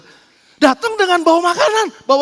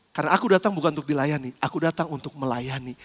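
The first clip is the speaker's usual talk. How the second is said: low, hushed, even recitation; solemn scripture quote; 11 semitones lower, 10 dB quieter than usual. Acted. Preacher quoting Jesus on serving others, low and hushed. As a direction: drop low and soft for the sacred words.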